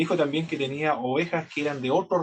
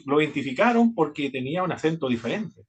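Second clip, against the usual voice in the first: normal talking voice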